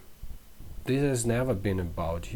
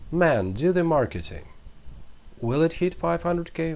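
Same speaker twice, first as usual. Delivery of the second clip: dismissive retort; 7 semitones higher, 4 dB louder than usual. Retold or imitated. Imagined retort to marketing friend.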